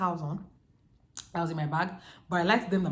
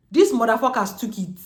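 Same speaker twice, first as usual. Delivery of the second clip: louder, bitter swearing; angry outburst; 5 semitones higher, 10 dB louder than usual. Real, not acted. Narrator cursing the robbers who took her mother's gifts, angrily. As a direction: spit it out at the thieves.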